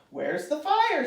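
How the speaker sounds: high, urgent shouted question; chief's shout. A narrator's reading. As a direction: call out as a fire chief on arrival. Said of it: "shouted the chief".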